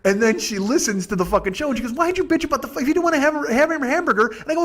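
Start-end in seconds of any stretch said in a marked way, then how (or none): 1.9-4.3 mock whiny girlfriend. Quoting Maki's complaint.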